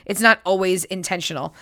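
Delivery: ordinary delivery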